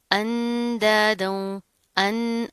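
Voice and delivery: अंदादों slowly, humming on the n; held nasal ghunnah. Shown as a demonstration. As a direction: hold the n of अंदादों through the nose. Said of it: "गुन्ना करेंगे". (अंदादों में नून पर गुन्ना)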